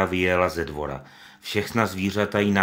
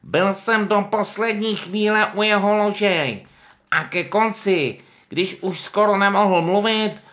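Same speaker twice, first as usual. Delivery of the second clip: much higher, slow, louder pig voice; Pištík; 14 semitones higher, 7 dB louder, slower than usual. Acted. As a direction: put on a tearful show of grief. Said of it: "řekl Pištík".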